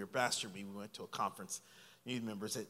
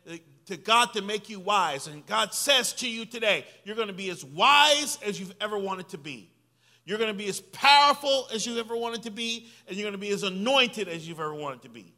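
louder, higher booming prophecy; prophet's declaration; 7 semitones higher, 9 dB louder than usual. Retold or imitated. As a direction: proclaim it like the prophet over him. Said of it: "he said, uh".

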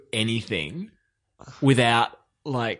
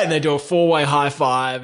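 ordinary talk

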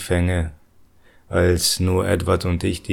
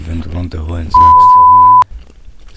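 censor beep; 8 dB louder, slower than usual. Pretend.

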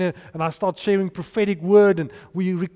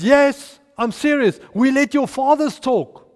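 loud, higher rallying command to a crowd; Joshua commanding; 5 semitones higher, 6 dB louder than usual. Retold or imitated. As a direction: lay down the one rule to the people. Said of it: "He says".

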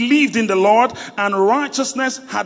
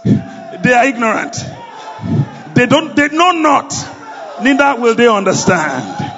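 rally speech; 5 semitones higher, 4 dB louder than usual. Acted. Preacher rousing the congregation.

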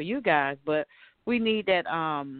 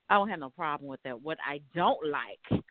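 normal talking voice

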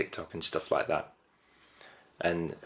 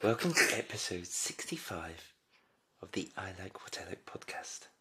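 put-on posh accent; 7 dB quieter than usual. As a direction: put on a hushed upper-class English accent. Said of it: "I'm trying to do a posh accent".

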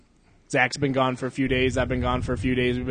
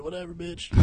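much softer, higher mock mumble; dismissive boyfriend; 5 semitones higher, 10 dB quieter than usual. Host voicing the unimpressed dude at the concert, quietly dismissive. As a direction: toss it off quietly, not caring. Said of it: "the dude was like".

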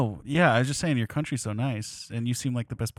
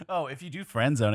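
ordinary talk, nothing marked

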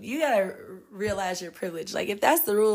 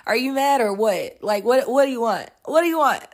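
higher, louder exasperated retort; mock exasperation, louder and higher; voicing the fed-up staff; 6 semitones higher, 5 dB louder than usual. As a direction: snap back at the complainers. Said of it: "Because it was like".

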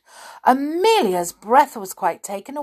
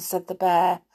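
ordinary delivery

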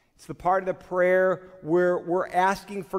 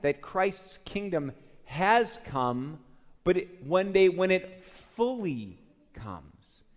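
usual speaking voice

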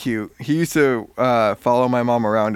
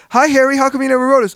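much higher, fast, louder mock greeting; mock classmates' greeting; 12 semitones higher, 5 dB louder, faster than usual. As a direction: parody their cheery question quickly. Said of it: "When they like".

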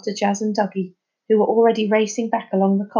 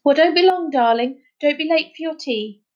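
shouted; 7 semitones higher than usual. A narrator's reading. Mother calling after her daughter, shouted. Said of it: "then shouted".

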